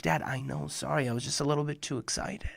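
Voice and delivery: soft, quick embarrassed apology; a boy apologizes softly to his dad; sheepish child. Retold or imitated.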